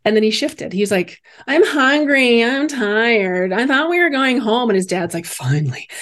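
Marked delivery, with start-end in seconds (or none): 1.4-4.7 put-on high, whiny child's voice; put-on kid's grumble, pitched up; complaining little boy. Boy's mood shifts, voiced complaining. 5.3-5.9 low, dry dad's voice; relieved dad. Retold or imitated.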